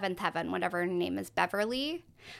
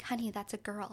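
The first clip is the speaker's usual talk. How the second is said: 1 soft teacher correction; 9 dB quieter, faster than usual. Retold or imitated. Teacher gently corrects the child.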